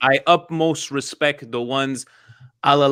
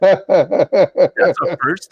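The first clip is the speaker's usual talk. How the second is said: laughing; 4 semitones higher, 4 dB louder, much slower than usual. Real, not acted. Laughing after disbelief.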